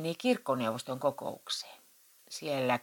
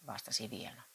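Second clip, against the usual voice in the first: plain reading voice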